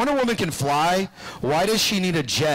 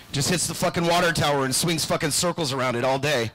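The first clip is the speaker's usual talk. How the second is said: ordinary talk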